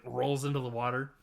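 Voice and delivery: ordinary talk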